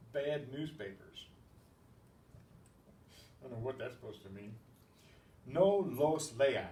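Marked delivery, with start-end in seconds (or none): 5.5-6.7 slow and louder, every word of No los lean set apart; slow emphatic model. Shown as a demonstration. Teacher modeling the negative command for the class, slow and firm. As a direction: lay out No los lean slowly and firmly. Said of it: "teacher models the command".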